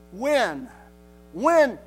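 "when" punched loud, falling, standing alone; stress on when. Real, not acted. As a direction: hammer each "when" as a certainty. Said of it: "Not a question of, of if".

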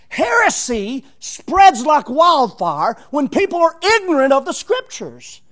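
loud, emphatic pulpit warning; full-force preaching. Acted. Preacher warning the church about heresy, loud and emphatic. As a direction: drive the warning home from the pulpit.

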